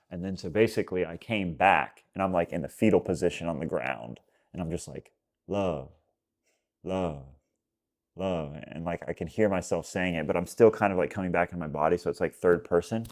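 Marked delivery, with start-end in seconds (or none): 5.5-8.5 soft, low, spaced-out chant; dazed repetition. Retold or imitated. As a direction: murmur it as if coming to. Retelling himself murmuring on the floor.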